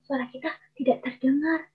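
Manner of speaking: small, faint voice; barely audible. Shown as a demonstration. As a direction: drop the voice as if it can't be heard. Shows a voice too small to hear.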